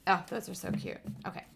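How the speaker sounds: ordinary talk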